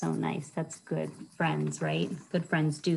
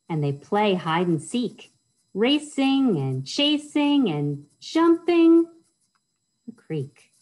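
racing and chasing said slow, higher and louder; storytime reading; 5 semitones higher, 6 dB louder, slower than usual. Acted. Librarian reads the chase scene to kids, excited storytime voice. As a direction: build the fun of the chase. Reads rhyming picture book aloud.

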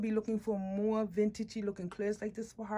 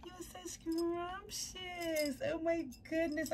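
high slow coo; 8 semitones higher, 5 dB quieter than usual. Real, not acted. Cooing at doll.

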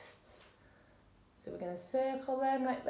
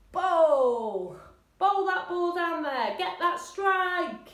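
loud, higher, drawn-out urging; rousing bowling call; 5 semitones higher, 5 dB louder, slower than usual. Shown as a demonstration. Coach urging class to get that strike, loud and rousing. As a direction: send the ball off with a big call.

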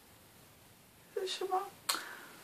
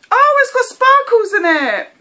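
high, loud squeal of delight; shrieky delighted exclamation; excited discovery; 10 semitones higher, 8 dB louder than usual. Real, not acted. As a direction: squeal it, thrilled by what you spot.

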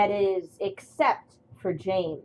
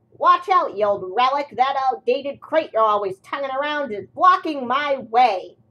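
loud, higher furious shout; angry yell; 6 semitones higher, 7 dB louder than usual. Acted. James yells at the old engine blocking his way, furious. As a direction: bellow him off the tracks.

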